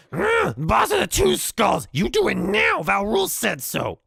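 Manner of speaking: high, loud goblin screech; shrill-pitched, full-voiced goblin bossing someone around; yelling goblin. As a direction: shriek the order like a furious little goblin. Goblin yells up at orc.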